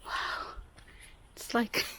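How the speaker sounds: breathy awed whisper; hushed whisper. Real, not acted. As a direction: whisper it in wonder. Awed hush at forest.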